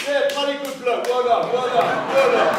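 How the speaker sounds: put-on applaud like a bluff old banker; hearty banker. Bankers clapping the walker, loud and hearty. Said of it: "They just don't know what to".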